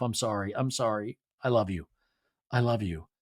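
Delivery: soft, low imitation of contrite affection; tender apology. Husband apologizing to his crying wife, tender and hushed.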